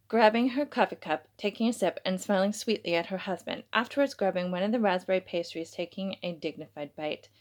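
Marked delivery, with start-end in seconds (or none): none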